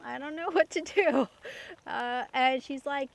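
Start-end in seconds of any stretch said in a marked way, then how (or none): 0.0-1.3 a higher, louder line broken by a laugh; laughing helplessly. Retold or imitated. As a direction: laugh through it at your own mess. Retelling being stuck at the pump.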